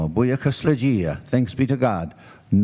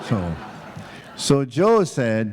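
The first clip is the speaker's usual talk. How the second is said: plain reading voice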